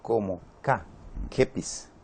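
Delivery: soft repetition. Shown as a demonstration. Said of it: "mi fonema siempre suena".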